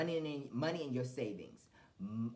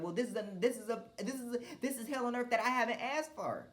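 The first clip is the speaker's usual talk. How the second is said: higher, exasperated outburst; frustrated complaint to God; 8 semitones higher than usual. Retold or imitated. Venting at God about unasked-for hell, exasperated and high. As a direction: vent the complaint upward, pitched high. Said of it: "I'm sitting up here like".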